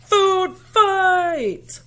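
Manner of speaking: high, loud rallying shout; yelled. Acted. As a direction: scream it across the room. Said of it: "Somebody yelled".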